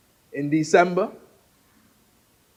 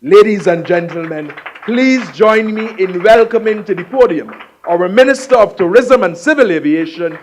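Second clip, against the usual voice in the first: louder ceremonial welcome, stress on "Honorable"; formal announcer intro; 8 dB louder than usual. Real, not acted. Host announcing the minister to the podium, ceremonial. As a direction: call the room to welcome the minister.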